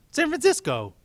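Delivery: exasperated shouted correction; loud correction. Acted.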